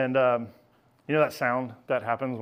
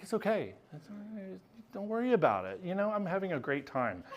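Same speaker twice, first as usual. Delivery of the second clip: higher, softer mock sweet reassuring voice; sweet reassurance; 8 semitones higher, 5 dB quieter than usual. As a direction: imitate her soothing him gently. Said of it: "she's just a total sweetheart".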